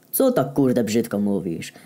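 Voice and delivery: slow, heavy thug voice; Heniek's voice. Acted. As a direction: drag it out like a big dumb brute. Heniek surprises narrator in car. (powolny głos osiłka)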